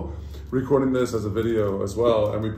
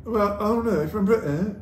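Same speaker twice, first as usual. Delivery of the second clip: put-on British accent, pitched high; 9 semitones higher than usual. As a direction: tease with a mock British lilt. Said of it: "Did I have a British accent?".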